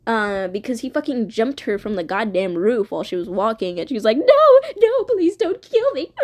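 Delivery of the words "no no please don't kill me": high, loud panicked begging; over-the-top screamed plea; mock terrified plea. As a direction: beg for your life, hammed-up. Acts out victim pleading for life.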